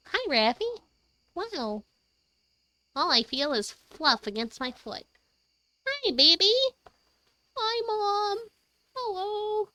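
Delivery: high, sweet voice for a pet. Real, not acted. Greeting her cat.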